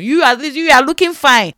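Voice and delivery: retold protest; accusing retort. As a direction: throw it at the well-dressed consoler. Mimics well-dressed person consoling her.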